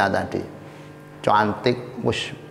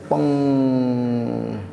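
long, ringing "peng"; imitated falling noise. Storyteller imitating the leap from the window, drawn-out. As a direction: draw out a sustained sound effect.

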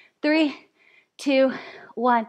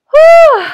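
high, loud exhale of relief; relieved whew; 13 semitones higher, 14 dB louder than usual. Real, not acted. Coach finishing the plank with class, loud relieved whew. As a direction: blow out the effort after the set.

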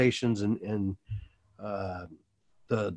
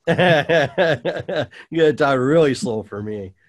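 higher, louder, coming out of a laugh; laugh, then make the joking plea; amused laughing; 3 semitones higher, 5 dB louder, much slower than usual. Real, not acted. Joking about keeping up with notes.

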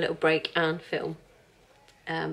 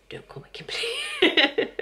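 mock protest; slower than usual. Complaining about her name.

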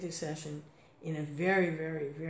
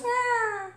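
high squeaky mock cat yowl, much louder; cat's cry imitated; 6 dB louder than usual. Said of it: "she goes".

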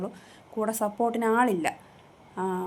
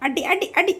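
higher, louder playful scold; 9 semitones higher, 7 dB louder than usual. Real, not acted. Owner scolds her biting pet, high loud playful scold. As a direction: tell the biting pet off in fun.

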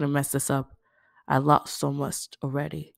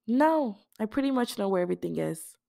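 Dee, casual; 7 semitones higher than usual. Acted. Dee replies.

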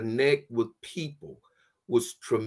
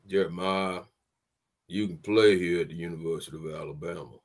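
lower, slow-starting Southern coach murmur; Coach Bryant's drawl; 6 semitones lower, 4 dB quieter, faster than usual. Retold or imitated. Coach telling freshman Jeremiah he can play, lower Southern drawl. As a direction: play the old coach, low and measured. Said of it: "he had that old Southern drawl".

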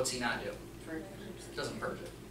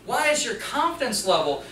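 normal talking voice

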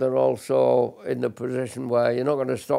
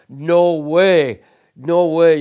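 loud, higher, forceful no way; emphatic denial; 3 semitones higher, 9 dB louder, much slower than usual. Real, not acted. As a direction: reject the movie myth outright. Veteran rejecting film cliché.

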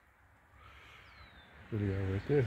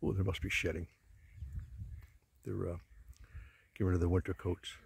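ordinary delivery

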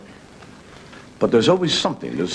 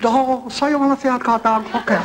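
higher, sing-song mock Japanese accent; 12 semitones higher than usual. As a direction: play the Japanese dog breeder with a hammed-up accent. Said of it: "there's a Japanese fellow".

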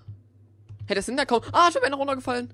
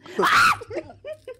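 high loud burst of laughter; shrieking laugh; 6 dB louder than usual. Real, not acted. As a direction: cackle out loud. Lachanfall nach Schreck. (schrilles Auflachen)

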